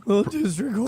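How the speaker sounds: cracking up high on district; mock voice crack. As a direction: squeak the words like a breaking voice. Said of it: "Little voice crack there".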